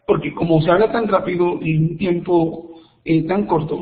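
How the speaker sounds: ordinary delivery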